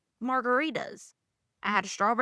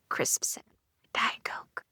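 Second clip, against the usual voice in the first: whispered; 5 dB quieter than usual. Real, not acted. Mumbled aside while drinking.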